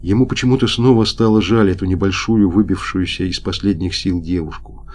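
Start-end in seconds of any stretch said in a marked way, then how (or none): none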